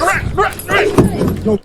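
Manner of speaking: high, urgent cheering on; excited urging. Real, not acted. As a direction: urge them to run. Reacting to footage of a chase.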